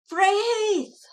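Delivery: a sudden high, loud cry of fright; boy shrieks in terror at the attic creature; frightened cry. A narrator's reading. Boy in the attic cries out at the creature, high and loud in fright. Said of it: "he cried".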